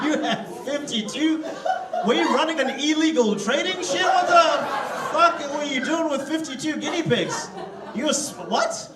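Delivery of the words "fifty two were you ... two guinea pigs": shocked. Real, not acted. Shocked by 52 guinea pigs.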